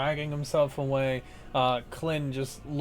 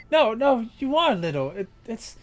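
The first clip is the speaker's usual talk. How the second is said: a bit higher, amused; lightly voiced teasing harpy; 8 semitones higher, 6 dB louder than usual. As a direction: tease him gently. [name] voice teasing.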